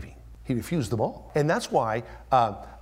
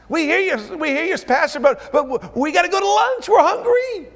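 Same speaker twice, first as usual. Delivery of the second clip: very high, loud whine; joking whiny voice; mock hungry congregation; 9 dB louder than usual. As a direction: tease them about lunch. Congregation mocked wanting lunch.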